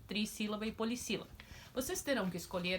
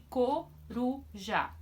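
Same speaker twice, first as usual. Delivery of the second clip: slow example word; 3 semitones higher, much slower than usual. Shown as a demonstration. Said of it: "três emissões".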